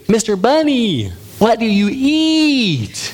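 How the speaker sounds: high, drawn-out cartoon frog voice; wide-mouthed frog. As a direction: stretch the words wide like a big-mouthed frog. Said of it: "there's a wide-mouthed frog".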